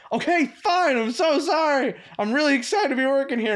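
high-pitched, pretend-argument apology; mock yelling back. Intern playing along with the assistant manager's fake row, mock yelling. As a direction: play along with a staged shouting match. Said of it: "it just looked like we were just yelling at each other".

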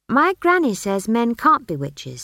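pert, high-pitched retort; defiant child. A narrator's reading. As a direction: argue back like a stubborn child. Child Esk argues.

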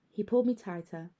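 usual speaking voice

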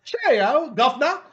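high, loud mock English; hammed-up cheery English accent. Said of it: "they wanted him English".

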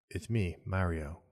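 put-on plain, unaccented and slow, no character at all; Chris Pratt. Host mocking the movie trailer's casting, deadpan ordinary voice. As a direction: deliver the catchphrase in an underwhelming everyday voice. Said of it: "him doing his own voice".

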